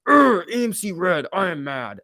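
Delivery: hammed-up grumpy investor griping that the stock is down; mocking mad investor. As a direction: sneer it out like a sulky bagholder watching red. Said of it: "Can I do that impression again?".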